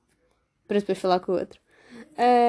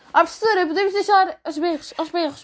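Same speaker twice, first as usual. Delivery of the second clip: higher, louder mock call to the teacher; loud classmate impression; 6 semitones higher than usual. Imitating the loud girl asking the teacher at the end of class, mock yell. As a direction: belt it out like the girl asking to leave. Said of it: "quando eu vos digo aos berros, é aos berros".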